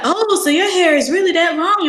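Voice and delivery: lightly imitated teacher, pitched high; high, questioning mock-teacher voice; nosy teacher. Teacher questioning a student's box braids, high imitated voice. Voicing teacher's comment on her hair.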